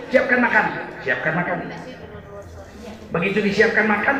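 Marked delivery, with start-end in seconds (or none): 1.0-1.7 much lower and softer; wife quietly giving in; meek echo. Retold or imitated. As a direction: murmur it back submissively. Wife quietly echoes order.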